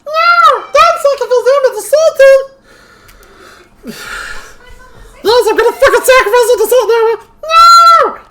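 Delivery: shrill high gremlin yelling back and forth; gremlin shouting. Acted. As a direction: argue with yourself, screaming. Gremlin argues with itself.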